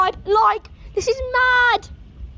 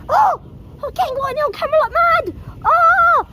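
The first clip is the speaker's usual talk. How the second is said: high, fast thrilled cries; whooping at the storm on camera; breathless thrilled shouts; excited yelling; 7 semitones higher than usual. Real, not acted. Lightning filling camera frame.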